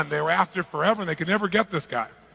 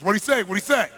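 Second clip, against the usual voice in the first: louder, raised urgent question; impatient demand; 3 semitones higher, 5 dB louder than usual. Acted. Mafia boss pressing the interpreter for an answer, loud and impatient. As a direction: fire the question at the interpreter, insistent.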